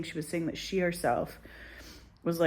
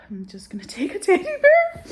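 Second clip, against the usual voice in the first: much higher, louder squeak; squeaky voice; 9 dB louder than usual. Real, not acted. Dreading the dentist, a high childlike squeak.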